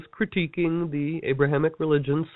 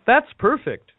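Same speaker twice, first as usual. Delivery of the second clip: high, bright exclaim of approval; delighted; 9 semitones higher, 5 dB louder than usual. Retold or imitated. Rabbi delighting in the shipwreck metaphor, high and excited. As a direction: cheer the idea, pitched high.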